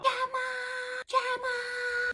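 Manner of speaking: high, loud shrieking call of the name; screaming a name. Retold or imitated. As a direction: shriek it up to the window from below. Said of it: "to her screaming out my window".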